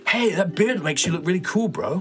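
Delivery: high surfer-bro voice. Acted. Surfer friend complimenting beard, high bro voice. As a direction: gush like a laid-back surfer.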